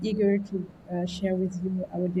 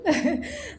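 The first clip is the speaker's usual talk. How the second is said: high laugh; 12 semitones higher than usual. Real, not acted. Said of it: "Just to add a bit of spice".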